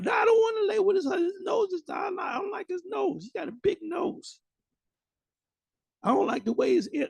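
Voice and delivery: very high put-on falsetto; mock whining woman. Preacher mimics a handmaid refusing the husband, mock high-pitched whine. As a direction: squeal the complaint in a mocking high voice.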